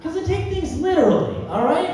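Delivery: high, loud comic outburst; mock indignation. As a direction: yell it back as an exasperated explanation. Mock-indignant answer to "Why?.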